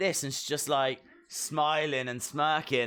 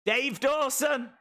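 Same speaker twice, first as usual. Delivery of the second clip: high, slow announcer-style call; 8 semitones higher, slower than usual. Real, not acted. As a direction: stretch the name out like a ring announcer. Calling out a wrestler's name.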